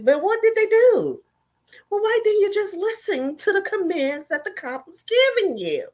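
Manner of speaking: high, loud mock-innocent questioning. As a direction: ham up a clueless high voice.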